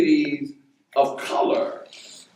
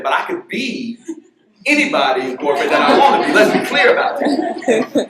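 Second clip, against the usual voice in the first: put-on defiant boy, laughing; 8 semitones higher, 9 dB louder than usual. Son telling the teacher off, high and loud with laughter.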